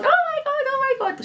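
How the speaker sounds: very high squealing oh my God; excited squeal. Retold or imitated. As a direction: squeal it way up, thrilled. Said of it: "She's running about the shop going".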